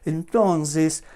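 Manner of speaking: ordinary delivery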